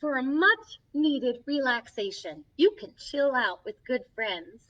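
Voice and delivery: gentle picture-book reading; reading to children. Acted. Storybook host reading a friendship book to kids, gently. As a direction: read each page to the little ones.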